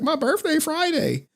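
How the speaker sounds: very high-pitched mock woman's voice; excited assistant. Imitating his assistant announcing her birthday, high and excited. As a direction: squeal it like an eager coworker.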